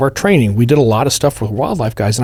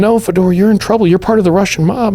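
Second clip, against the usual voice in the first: high, firm mock scolding; firm agents; 9 semitones higher, 3 dB louder than usual. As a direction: set him straight. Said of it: "they're like".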